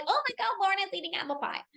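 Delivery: high, hushed gossip; high-pitched mock gasp of judgement, kept soft; mock scandalized. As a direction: imitate shocked onlookers judging. Imagined people judging her.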